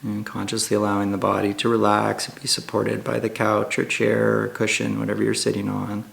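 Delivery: calm, hushed meditation guide with long pauses; guided meditation. Acted. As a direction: ease listeners gently into stillness, unhurried and even.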